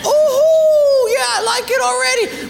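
very high and loud, gushing delight; thrilled shout. Acted. The man promised pools shouts to his benefactor, ecstatic. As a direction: yell it like a kid seeing a pool slide. Acted rich gift recipient.

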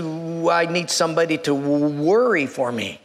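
put-on low, stuttering old man's statement; shaking elderly man. Old boss explains the job, shaky stammer. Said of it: "this man is kind of shaking".

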